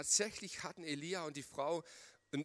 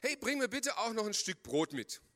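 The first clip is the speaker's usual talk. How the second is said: calling after her; 7 semitones higher, 3 dB louder than usual. Acted. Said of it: "rief er ihr nach".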